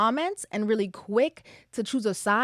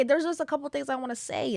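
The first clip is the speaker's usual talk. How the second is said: ordinary delivery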